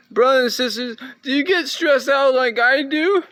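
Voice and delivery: high, loud hail to the congregation; loud, high-pitched playful sermon opener; mock preacher call. Hailing viewers like a congregation.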